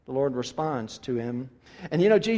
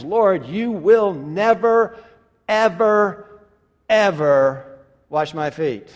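slow, louder never ever ever; emphatic refusal; 3 semitones higher, 8 dB louder, slower than usual. Acted. Peter refusing Jesus, stubborn and emphatic. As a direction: dig in your heels on every ever. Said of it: "Peter is saying something more along the lines".

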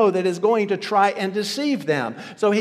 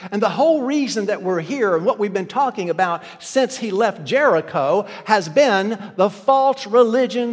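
louder, driving sermon voice; full-force preaching; 4 semitones higher, 6 dB louder than usual. Acted. Pastor hammering home the church's danger, preaching loud. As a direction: preach it hard to the congregation.

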